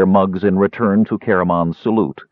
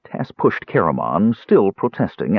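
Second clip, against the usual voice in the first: ordinary delivery, nothing marked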